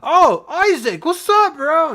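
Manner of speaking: very high, loud hailing; exaggerated high, loud greeting; bro-ish classmate. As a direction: call out to a buddy across the cave. Classmate Chet greets Isaac.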